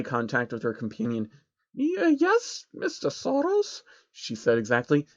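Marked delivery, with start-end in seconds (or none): 1.7-3.8 much higher timid pony voice; small high stammered yes; Trixie's high voice. A narrator's reading.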